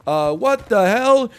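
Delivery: loud, high, drawn-out exclamation; mocking streamer impression. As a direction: exaggerate a mocking imitation of the streamer. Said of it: "here's my new impression of you".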